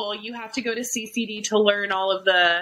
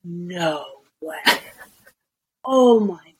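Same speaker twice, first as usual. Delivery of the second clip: slow, drawn-out mock amazement; amazed kids; 4 semitones lower, 3 dB louder, slower than usual. Host mimics kids watching food videos, amazed kids. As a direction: imitate awestruck kids, dragging each word. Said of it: "the kids are like".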